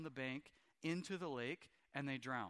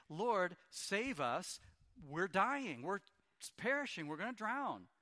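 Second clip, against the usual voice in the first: loud, higher, slow desperate plea; panicked cry; 4 semitones higher, 4 dB louder, slower than usual. Acted. Disciples begging the sleeping Jesus, panicked loud cry. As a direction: cry out as if the boat is sinking.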